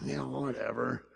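soft, breathy, dismissive sigh; sighing whatever. Retold or imitated. Preacher mimicking himself when told to obey, soft sighing dismissal. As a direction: sigh it off like a bored teen.

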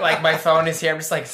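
ordinary talk, nothing marked

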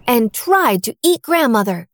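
ordinary delivery, nothing marked

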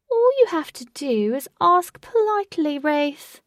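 high, loud theatrical sing-out; taunting villain. A narrator's reading. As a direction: toy with the captive from afar. Unseen villain taunts Wraith.

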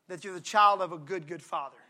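softly, dropping low on good father; hushed and tender, like a lullaby line. Real, not acted. Preacher softening to a hush for the congregation. Soft tender aside in sermon.

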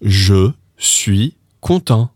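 slow, careful "Je suis content"; French demo. Shown as a demonstration. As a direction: model "Je suis content" slowly for learners. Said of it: "if you want to say".

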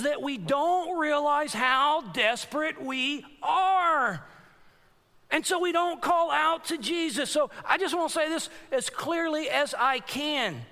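preacher pleading with the congregation; fervent preaching. Acted. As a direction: press the warning home to the church. Preaching climax.

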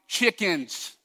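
mildly hammed-up Goliath bellow; a preacher lightly voicing a boastful giant; mock giant's taunt. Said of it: "Goliath shouting".

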